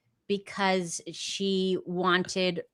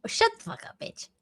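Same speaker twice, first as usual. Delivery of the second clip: exasperated; 6 dB quieter than usual. Real, not acted. Cohost annoyed at his jab.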